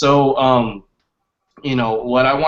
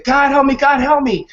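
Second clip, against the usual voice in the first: high, loud calling out; raised, high-pitched storytelling shout; imitated cry for help; 12 semitones higher, 5 dB louder than usual. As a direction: act out a drowning man crying to heaven. Drowning man calls out in story.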